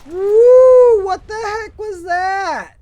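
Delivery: high, loud yelp of surprise, dragged out; startled shout. Real, not acted. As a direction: jump out of your skin and yell. Startled by an enemy.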